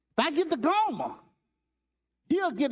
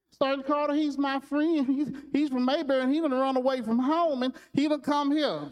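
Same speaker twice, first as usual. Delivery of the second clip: pleading Gomer; 8 semitones higher than usual. Retold or imitated.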